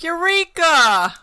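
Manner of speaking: high, loud, drawn-out Eureka; mock eureka shout. Teasing friend about remembering on the toilet, mock triumphant shout. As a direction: shout it like a sudden brainwave, stretched out. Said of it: "You'll be like".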